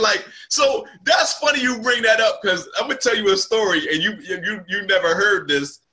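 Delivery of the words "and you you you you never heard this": ordinary delivery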